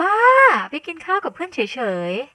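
high, indignant brush-off; high-pitched denial. Acted. Sister still denying a date, high-voiced. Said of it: "หมิงยังคงปฏิเสธเสียงสูง".

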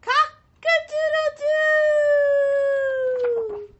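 very high, loud, slow crow; the rooster's voice is back; proud rooster crow. Acted. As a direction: belt out cock-a-doodle-doo. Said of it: "finally got his voice back".

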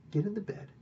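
urgent whisper. Retold or imitated. Friend tells the king to fake sleep, urgent whisper. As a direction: hiss the order softly.

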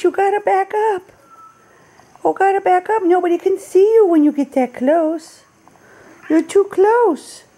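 high cooing pet talk, telling the cat to back up. Real, not acted. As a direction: coo at the kitty to back off the camera. Long baby talk to the cat.

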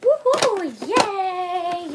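high, loud woohoo and yay; squealed celebration for the little one; cheering. Acted. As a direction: cheer like the baby just won something. Cheering for the baby.

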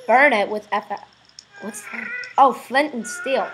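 ordinary delivery

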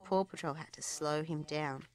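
plain reading voice

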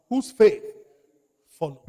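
shouted high and loud; booming pulpit emphasis; emphatic call. Shown as a demonstration. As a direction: fling the phrase at the congregation. Preacher drills the key phrase.

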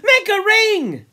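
high, loud, drawn-out command; shout. Acted. Jack ordering the boys into a circle, shouting. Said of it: "Jack shouted".

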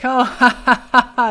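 loud mock ha-ha-ha; put-on laugh. Man answering a flirty remark, exaggerated fake laugh. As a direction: fake being tickled by a joke. Voices man's reply to flirt.

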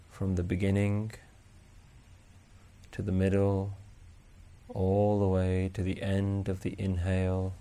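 slow, soft, even meditation voice; guided meditation. Acted. Meditation teacher guiding listeners to notice each breath, calm and unhurried. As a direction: guide them gently through the breath with long pauses.

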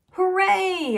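high, loud cheer from a whole class; excited cheer. A narrator's reading. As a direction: shout it out with glee. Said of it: "everyone shouted".

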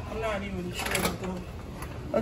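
ordinary talk

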